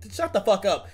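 ordinary talk, nothing marked

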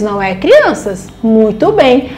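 ordinary delivery

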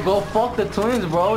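normal talking voice

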